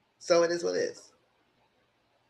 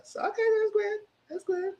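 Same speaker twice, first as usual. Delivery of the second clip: mock falsetto; 6 dB quieter than usual. Mocking approval of kiss.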